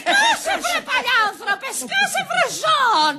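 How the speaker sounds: high-pitched screech of abuse; enraged wife at the top of her voice; furious shout. Acted. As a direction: scream the intruder into silence. Wife silences the drunk intruder.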